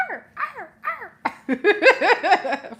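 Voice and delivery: high yapping "Arr, arr" barks like a dog; mock dog bark. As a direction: bark like an eager little dog. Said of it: "You want me to bark like a dog?".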